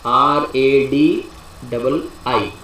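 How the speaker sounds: "आर ए डी डबल आई" spelled letter by letter, slowly; spelling out letters. Shown as a demonstration. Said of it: "we will call it radii". ("आर ए डी डबल आई" को धीरे-धीरे अक्षर-अक्षर बोलना)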